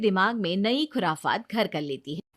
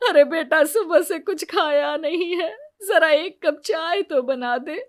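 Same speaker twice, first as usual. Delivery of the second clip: high, tearful-sounding plea; old woman begging her elder bride for tea; pleading mother-in-law; 8 semitones higher, 4 dB louder than usual. Acted.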